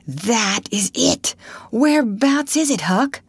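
excited realisation. Acted. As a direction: ask eagerly where the sound is. Tom excitedly locates the snoring.

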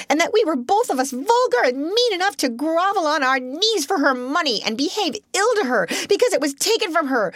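hysterical outburst. Acted. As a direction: let the hysterics carry every line. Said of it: "hysterical enough not to care".

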